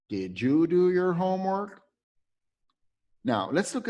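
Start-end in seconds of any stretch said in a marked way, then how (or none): 0.1-1.8 high, lightly acted asking voice; voiced question. Teacher voicing the asker in the example, higher. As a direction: ask it as a character would.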